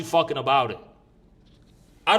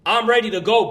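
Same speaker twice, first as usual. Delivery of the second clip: usual speaking voice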